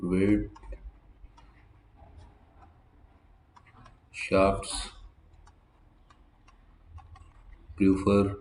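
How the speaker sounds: Wirtschaftsprüfer stretched out very slowly and softly; slow demo. Shown as a demonstration. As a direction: draw out every syllable of Wirtschaftsprüfer. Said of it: "teacher repeating for learners". (Wirtschaftsprüfer बहुत धीरे खींचकर)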